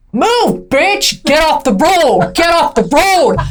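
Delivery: high, drawn-out road-rage yelling. Retold or imitated. Wife imitating herself driving, aggressive road rage. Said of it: "You're so aggressive".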